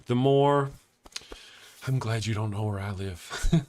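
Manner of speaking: ordinary talk, nothing marked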